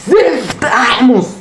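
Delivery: high, slow, drawn-out curse; frustrated groan. Real, not acted. Gamer cursing his failed run, slow, high, drawn-out groan. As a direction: drag the curse out in exasperation.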